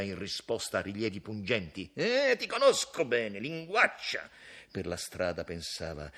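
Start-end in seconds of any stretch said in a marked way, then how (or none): 1.9-4.3 teasing retort. Acted. Major's retort.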